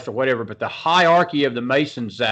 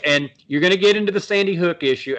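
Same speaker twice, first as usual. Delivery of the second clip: normal talking voice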